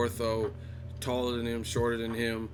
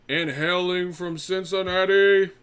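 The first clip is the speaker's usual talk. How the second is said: much higher, louder, very slow drawn-out ring intro; ring announcer; 10 semitones higher, 5 dB louder, much slower than usual. Pretend. Introducing the streamer like a fighter, booming slow ring announcer. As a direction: stretch the name like a boxing announcer.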